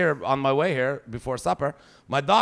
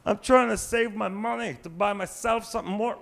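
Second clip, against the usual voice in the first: higher, lightly voiced teenage daughter; dad mimicking his daughter's excuse; mock daughter; 6 semitones higher than usual. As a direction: whine it like a stingy teen. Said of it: "quoting daughter".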